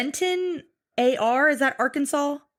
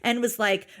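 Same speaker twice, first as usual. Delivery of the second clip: normal talking voice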